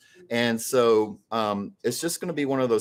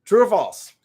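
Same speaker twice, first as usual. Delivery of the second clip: excited host call; 6 semitones higher, 7 dB louder than usual. Acted. Game host calling out question.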